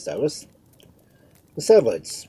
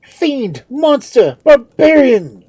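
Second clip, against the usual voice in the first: high, loud, building insults; enraged shouted accusation; furious cry; 16 dB louder, slower than usual. A narrator's reading. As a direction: hurl each word louder than the last. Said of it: "cried he, addressing Cheops".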